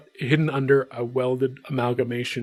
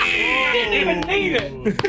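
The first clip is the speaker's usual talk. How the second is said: much higher, slow gleeful tease on Didn't; delighted drawl; much slower than usual. Real, not acted. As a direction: stretch Didn't even need it out gleefully. First take, drawn out.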